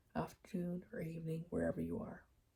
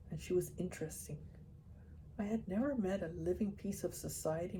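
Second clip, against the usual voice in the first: steady, fuller audiobook reading voice; story narration; 9 dB louder than usual. A narrator's reading. Reader telling listeners the chapter, audiobook narration. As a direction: read the chapter aloud to listeners. Reads a novel chapter aloud.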